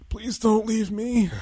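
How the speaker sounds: high, slow whimpering plea; mock pleading. Owner to his aging dog, slow high mock whimper. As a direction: beg the old dog like a heartbroken child. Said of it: "I'm like".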